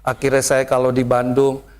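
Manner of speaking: ordinary talk, nothing marked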